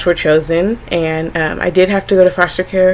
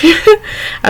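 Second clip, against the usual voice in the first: laughing; 13 semitones higher than usual. Real, not acted. Laughs at the memory.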